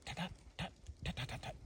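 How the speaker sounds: quick quiet tap notes; soft tapping cackle. Shown as a demonstration. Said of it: "a little bit of a short cackle".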